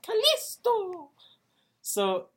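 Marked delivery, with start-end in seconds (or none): 0.0-1.1 put-on high, drawn-out Spanish-accented shout-out; exaggerated Spanish Kalisto, pitched way up; Spanish pronunciation. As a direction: stretch the name in a showy Spanish accent. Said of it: "says Kalisto in, like, the actual Spanish way".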